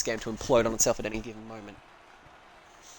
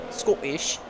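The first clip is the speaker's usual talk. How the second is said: badly faked Scottish accent, pitched higher; attempted Scottish accent; 4 semitones higher than usual. Shown as a demonstration. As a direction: have a clumsy go at a Scottish accent. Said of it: "It doesn't sound like it's Scottish".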